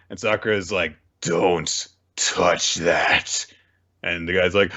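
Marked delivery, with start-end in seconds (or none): 1.2-3.5 slow warning. Acted. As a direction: warn him slowly. Sakura guarding charm.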